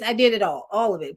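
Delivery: ordinary talk, nothing marked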